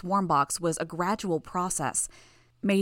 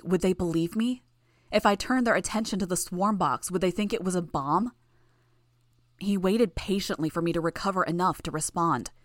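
plain reading voice

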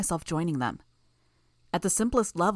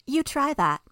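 higher, dry mock encouragement; sarcastic; 9 semitones higher than usual. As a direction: say it as if the plan were silly. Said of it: "injecting a note of sarcasm".

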